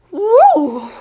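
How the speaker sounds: sudden high yelp; startled yelp. Real, not acted. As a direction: jump and yelp.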